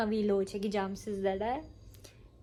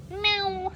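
high playful meow; high mock meow; imitated meow; 10 semitones higher, 3 dB louder than usual. Real, not acted. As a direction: meow back at the cat. Meowing at the cat.

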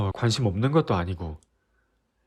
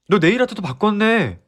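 너 네일 아트도 바꿨네 blurted higher and louder, falling at the end; exasperated outburst; 9 semitones higher, 5 dB louder than usual. Acted. Boyfriend proving to girlfriend he noticed, loud and exasperated. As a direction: fire off 너 네일 아트도 바꿨네 like proof, fed up.

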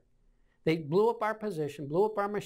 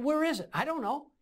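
high, exasperated back-and-forth; pitched-up frustrated quarrel; retold squabble; 9 semitones higher than usual. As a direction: re-enact a heated exchange, not shouted. Retold quarrel over rifle.

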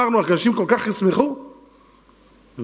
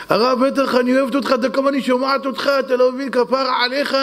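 imitating gushing wife; 5 semitones higher than usual. Wife gushing over the rabbi.

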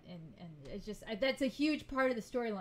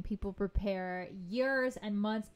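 ordinary delivery

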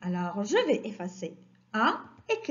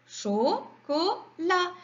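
"Chocolat" slow and higher, cho... co... lat; syllable by syllable; 5 semitones higher, much slower than usual. Shown as a demonstration. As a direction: sound out "Chocolat" in three beats. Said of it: "Et la dernière syllabe". (« Chocolat » lentement découpé en syllabes)

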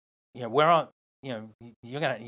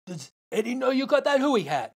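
much higher, pointed question; challenging; 10 semitones higher, 5 dB louder than usual. Retold or imitated. Hui guys challenging the surfer about the hat, higher. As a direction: taunt him with the question. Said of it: "and they go".

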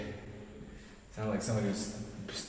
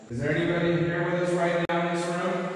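raised voice to the empty room; calling out loudly; 7 semitones higher, 6 dB louder than usual. Real, not acted. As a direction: call out to any spirit. Calling out to spirits.

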